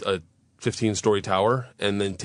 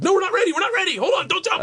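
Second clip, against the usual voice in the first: high, frantic pleading shout; imitated alarm, voices pitched way up; panicked crew; faster than usual. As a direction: yell to stop someone about to jump. Crew panicking as he threatens jump.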